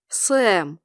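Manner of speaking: low, chiding name; reproachful. A narrator's reading. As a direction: say the name with a disapproving drop. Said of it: "укоризненно покачал головой Кори".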